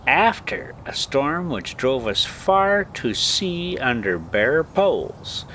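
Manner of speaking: usual speaking voice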